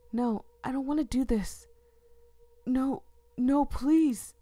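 loud, breaking plea; desperate begging. Acted. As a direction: beg as if losing control. Begging not to stab.

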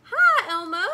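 loud high happy call; cried out. A narrator's reading. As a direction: call out cheerfully across the room. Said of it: "she cried".

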